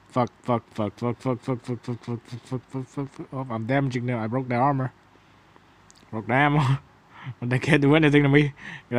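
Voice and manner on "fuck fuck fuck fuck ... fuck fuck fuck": soft, rapid-fire repeated swearing; panicked muttering. Real, not acted. Gamer being shot curses to himself, muttered panic. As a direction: mutter a string of curses under your breath.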